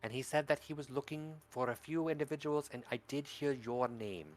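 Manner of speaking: put-on a hushed, kindly old granny; lightly voiced grandmother soothing a child; soft granny voice.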